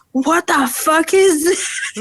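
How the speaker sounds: high, slow baffled question; mock baffled boyfriend. Imitating boyfriend texting a diaper photo, slow and baffled. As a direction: draw it out in disbelief. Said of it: "he was like".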